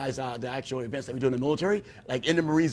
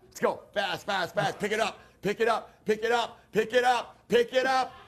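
barking gym coach; 6 semitones higher than usual. Pretend. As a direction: yell commands at a trainee. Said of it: "barking gym coach".

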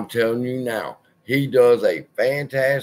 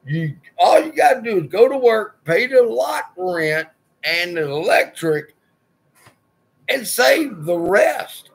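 higher and louder, building fed-up rant; exasperated rant; 7 semitones higher, 3 dB louder than usual. Retold or imitated. Landlord silently ranting at late tenants, exasperated. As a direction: vent at tenants who never pay. Fuming about tenants not paying.

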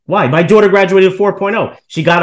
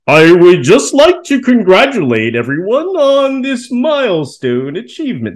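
slow, higher, stiff mock announcer voice like a robot; robotic college president; 4 semitones higher, 3 dB louder, slower than usual. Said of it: "sounds like he's artificial intelligence".

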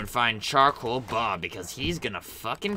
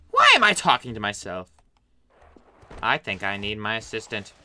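ordinary delivery

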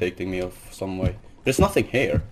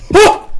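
sudden high shriek of fright; startled scream; 11 dB louder than usual. Real, not acted. Gamer jolted by a jump scare, screaming. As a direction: cry out as something jumps at you.

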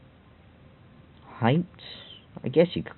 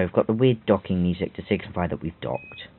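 ordinary talk

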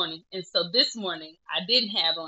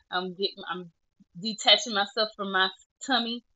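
normal talking voice

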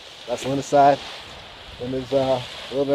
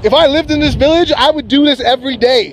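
higher and louder, breathless delight; real thrill; 9 semitones higher, 4 dB louder than usual. Real, not acted. Paraglider showing viewers the mountains, giddy and loud. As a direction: gush like you can't believe the view.

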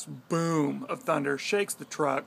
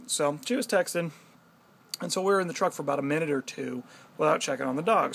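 ordinary talk

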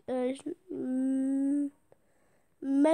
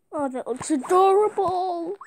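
ordinary delivery

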